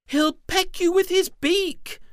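very high loud scared shriek. Acted. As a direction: shriek a warning in fright. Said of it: "Twirly let out a shriek".

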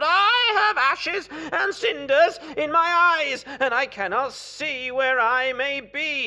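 high, very loud cry of distress; calling out. A narrator's reading. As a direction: wail out in pained alarm. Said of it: "he called out".

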